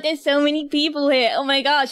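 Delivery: usual speaking voice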